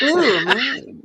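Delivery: high, put-on swoon; Larry impression. Larry dressed up as a woman, imitated in a high swoon. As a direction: coo it high, like a man playing a woman. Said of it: "That was Larry".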